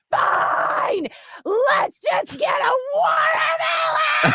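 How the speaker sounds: high screechy re-enacted yell; retold screaming. Mom screaming at her in the car, enraged. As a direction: scream it slowly, stretching the words. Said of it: "I'm screaming at her in the car".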